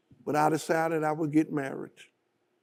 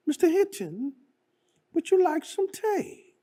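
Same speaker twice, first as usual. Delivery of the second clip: put-on British accent; 13 semitones higher than usual. Queen offers her visitor tea, in a high British accent. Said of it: "in her British accent".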